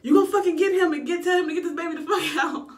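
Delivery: high, loud, fast shout, laughing at the end; mock yell at nurse. Mother in labour shouting at the nurse to fetch the doctor, loud and high. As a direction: bark it at the nurse. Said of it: "So the nurse was like".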